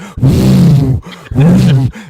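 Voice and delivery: loud, breathy swooping whooshes; whooshing blade noise. Acted. Stray turbine cartwheeling past the truck, loud mock whoosh. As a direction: imitate a huge blade swinging past.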